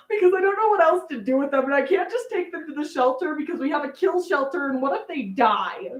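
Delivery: put-on high, fast, frantic pleading as her fifteen-year-old self; panicked teen self. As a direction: rush it out like a worried kid begging dad. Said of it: "And I'm like".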